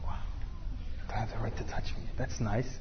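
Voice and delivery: hushed, low mock wonder; impressed women. Women hearing the brother's polite refusal, hushed impressed reaction. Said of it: "So women are like".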